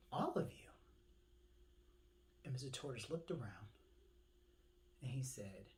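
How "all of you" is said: whispered bird. Acted.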